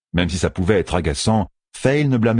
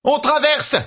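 very high, urgent call; urgent cry; slower than usual. A narrator's reading. Fail demanding a portal amid chaos, crying out. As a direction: cry out the order, pitched way up. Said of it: "cria Fail".

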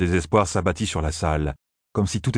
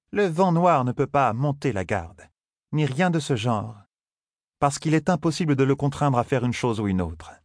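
higher, measured voice of a wise Aes Sedai; lightly voiced stern Aes Sedai; 8 semitones higher than usual. A narrator's reading. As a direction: speak as a calm, knowing sorceress correcting a boy.

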